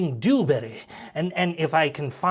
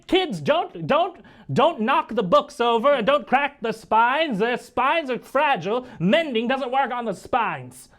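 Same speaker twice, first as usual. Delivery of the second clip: high-pitched, fussy scolding; high, fretful old-scholar nagging; fretting librarian; 9 semitones higher than usual. Acted. Professor scolding kids over books.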